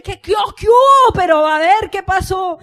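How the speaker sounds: high, loud, panicked yelling; frantic shout. Retold or imitated. Mother showing how she rushes the calm son, frantic shout. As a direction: shout like everything's on fire.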